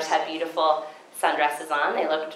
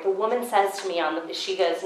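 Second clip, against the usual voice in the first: normal talking voice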